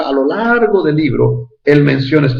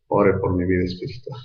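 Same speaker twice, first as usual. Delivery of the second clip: "Ore por mi vida espiritual" repeated lower and much softer; 8 semitones lower, 8 dB quieter than usual. Retold or imitated. Church members repeat their prayer request, quiet and fading. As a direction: echo the plea quietly, fading. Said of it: "los hermanitos se acercan a mí y dicen".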